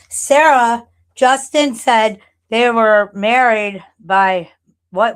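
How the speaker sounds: loud, slow call across the room. Real, not acted. Calling to Sarah in another room, loud and slow.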